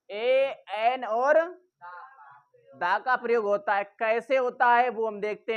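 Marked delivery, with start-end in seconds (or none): none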